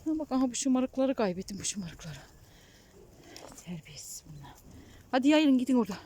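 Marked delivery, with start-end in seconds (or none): 3.7-4.5 whispered scold; whispering. Real, not acted. Scolding sheep under breath. 5.1-6.0 loud call to the flock. Real, not acted. Shooing sheep to graze.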